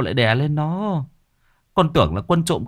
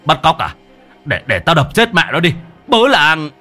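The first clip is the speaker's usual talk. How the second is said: loud, higher-pitched husband; enraged threat; 6 semitones higher, 5 dB louder than usual. A narrator's reading. Husband threatening the supposed kidnapper, enraged shouting. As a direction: bellow a threat and rouse the village.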